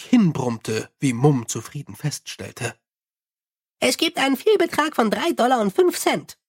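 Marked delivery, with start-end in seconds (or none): 3.8-6.4 high, chirpy little imp. Acted. Imp soothes the angry Mumm, high and chirpy. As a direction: pipe it up high like a tiny helpful imp. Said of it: "berichtete der Kobold".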